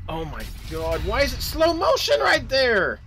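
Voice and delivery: climbing into a high, loud wail; exasperated outcry. Real, not acted. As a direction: start dismayed, build to a high yell. Exasperated by sudden slow motion.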